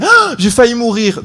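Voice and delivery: high, slow, dramatic wake-up cry; startled gasp. Retold or imitated. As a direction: jolt awake crying out. Girlfriend wakes startled. (cri aigu et théâtral au réveil)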